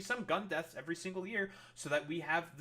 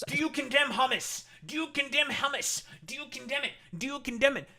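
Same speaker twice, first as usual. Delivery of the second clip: high, loud mock interrogation; hammed-up demand to condemn; mocking parody; 8 semitones higher, 5 dB louder than usual. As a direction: sneer it like a pushy TV host badgering a guest. Mocking Piers Morgan's demand to condemn.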